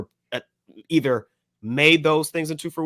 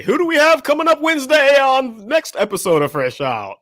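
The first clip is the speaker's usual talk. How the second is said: high, loud hype-man announcement; hype announcer call; 12 semitones higher, 7 dB louder than usual. Real, not acted. Host teasing next week's guest, loud and hyped. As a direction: build up the reveal like a game show host.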